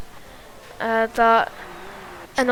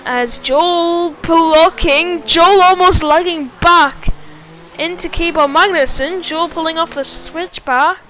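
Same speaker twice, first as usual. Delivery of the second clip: excited commentary; 5 semitones higher, 7 dB louder than usual. Real, not acted. Side-by-side battle call.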